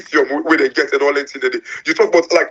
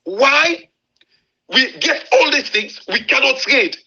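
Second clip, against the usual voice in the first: high, pleading outcry with a break in it; impassioned rant; 7 semitones higher than usual. Real, not acted. Host pleading to listeners about Africa's resources, impassioned. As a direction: rail against the waste, almost crying out.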